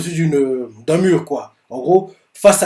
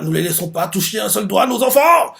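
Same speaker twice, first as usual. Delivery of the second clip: higher, rising battle cry; soldier's shout; 6 semitones higher than usual. Retold or imitated. Nameless soldier vowing to protect the kids, shouting. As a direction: shout it out to defend the children. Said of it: "on voit un no-name qui fait".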